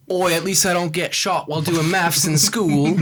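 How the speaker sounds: lightly done mock British accent, slow; a half-hearted Cockney jab; bad British accent. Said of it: "really bad British accent".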